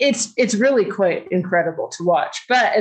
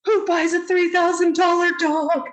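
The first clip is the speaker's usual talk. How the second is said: incredulous inner shout; 10 semitones higher than usual. Retold or imitated.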